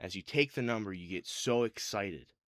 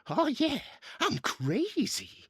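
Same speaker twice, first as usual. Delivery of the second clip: very high, loud maniac squeal; high-pitched crazy biker voice, drawn out at the end; crazed henchman; 3 dB louder, slower than usual. Acted. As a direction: shriek it like a gleeful lunatic. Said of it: "the guy goes".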